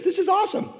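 a burst of glee; delighted. Real, not acted. Preacher thrilled picturing the village women, high and gleeful. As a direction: squeal it up high.

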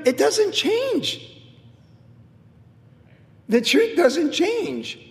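drawn out, every word weighed; slow it right down and land it; solemn preacher's insistence; slow emphatic declaration. Acted.

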